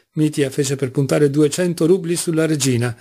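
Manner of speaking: usual speaking voice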